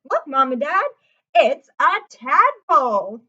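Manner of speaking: put-on loud, slow, proud kid showing off; excited boy. A narrator's reading. Lewis showing his parents the tadpole, loud and eager. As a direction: show it off to your parents. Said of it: "said Lewis".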